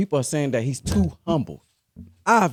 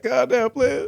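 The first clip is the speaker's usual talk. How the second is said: mock exasperation; 12 semitones higher than usual. Acting out the same exasperated man.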